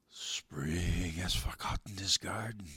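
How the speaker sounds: slow eerie whisper. Acted. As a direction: breathe it like cold frost. Snow and frost speak.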